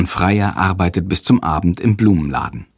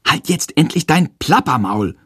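blurting it out a touch louder than meant; a bit too loud; 6 semitones higher than usual. Acted. Boy blurts at chattering teacher, a bit too loud. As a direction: snap it out, slightly too loud. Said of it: "ruft er aus Versehen etwas zu laut".

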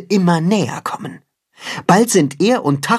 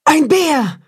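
shrieking terrified wolf; frightened cry; 13 semitones higher than usual. Acted. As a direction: cry out in sudden alarm. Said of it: "ruft die Wolfsmutter erschrocken".

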